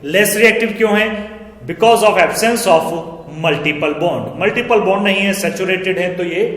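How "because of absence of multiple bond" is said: slow, spaced words for writing down; slow dictation. Shown as a demonstration. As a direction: dictate the reason word by word. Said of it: "लिख लीजिएगा". (धीमा dictation, लिखने के लिए)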